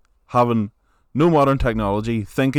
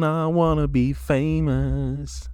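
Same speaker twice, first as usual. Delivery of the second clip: put-on slow, higher whine of a kid to his mom; a kid pleading with mom, slow whiny voice; 4 semitones higher, slower than usual. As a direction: drag it out like a sulking teen.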